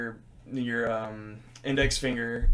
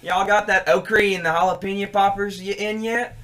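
Southern customer; 5 semitones higher, 5 dB louder than usual. Retold or imitated. Cashier mimics a Southern customer asking for okra, loud. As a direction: imitate the Southern man asking again, a bit pushy. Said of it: "some random Southern man".

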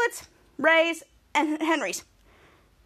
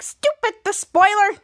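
mock angry; 5 semitones higher, 5 dB louder than usual. Cursing the spoiler.

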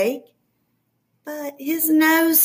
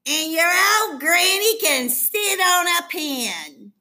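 rude pig yell; 5 semitones higher than usual. A narrator's reading. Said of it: "third little pig yelled".

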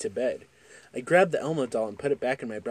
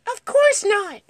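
put-on much higher, louder child; 8 dB louder, slower than usual. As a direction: protest like a scared kid. Child self quoted to dad.

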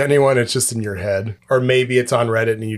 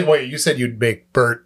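normal talking voice

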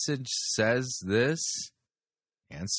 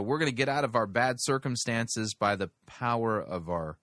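normal talking voice

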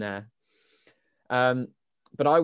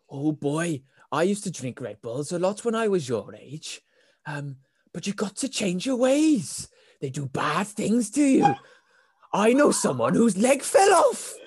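put-on Welsh accent; 6 semitones higher, slower than usual. Stranger warns customer off Red Bull, put-on Welsh accent. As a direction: warn in a drawn-out comic Welsh voice. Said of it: "he was Welsh".

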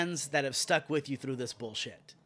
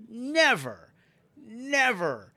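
a high, louder never, then never again; a raised double never; emphatic never; 7 semitones higher, 3 dB louder, much slower than usual. Real, not acted. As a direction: hammer never twice at the trolls. Ranting at internet trolls.

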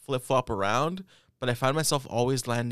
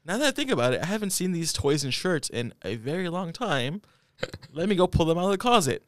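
put-on young kid voice; 6 semitones higher than usual. Kid thinking aloud.

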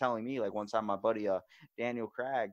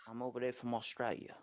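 softer, half-hearted Aussie accent; attempted Australian accent; 5 dB quieter than usual. Shown as a demonstration. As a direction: try an Australian accent tentatively. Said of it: "not even gonna attempt to do that one".

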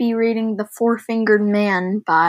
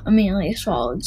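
plain reading voice

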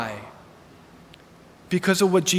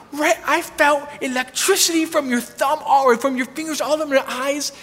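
lightly voiced woman, higher and amazed; 6 semitones higher than usual. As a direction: tell it in wonder, pitched up. Amazed woman describes electricity.

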